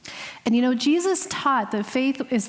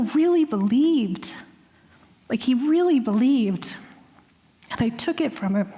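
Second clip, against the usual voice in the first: real tears welling, voice catching; on the verge of tears; 2 semitones higher than usual. Real, not acted. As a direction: let the emotion nearly break through. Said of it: "it's making me cry right now".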